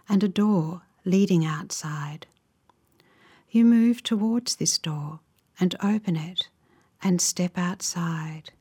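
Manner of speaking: unhurried, gentle phrases, leaving space to imagine; guided relaxation. Real, not acted. Guide leading listeners into a calming visualization, slow guided meditation.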